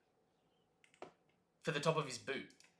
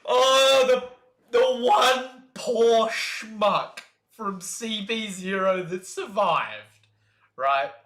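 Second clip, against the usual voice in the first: excited rant; 6 semitones higher, 8 dB louder, much slower than usual. Real, not acted.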